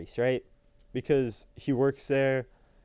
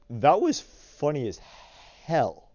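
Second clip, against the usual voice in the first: hell hit hard and louder, the h pushed out; stress on hell; 4 semitones higher, 5 dB louder, slower than usual. Shown as a demonstration. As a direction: lean on the "huh" of hell. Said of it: "emphasis on the "huh" in hell".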